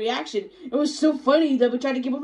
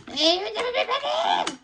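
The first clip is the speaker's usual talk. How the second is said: excited greeting; 7 semitones higher than usual. Acted. High, shouted greeting to viewers.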